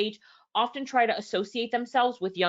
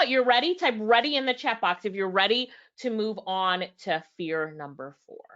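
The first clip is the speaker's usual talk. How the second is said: normal talking voice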